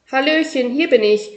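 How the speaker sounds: high, loud and bubbly; cheery greeting. A narrator's reading. Magpie Pinky greets Mister Morrison through the window, high and cheerful. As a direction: burst in with a bright hello.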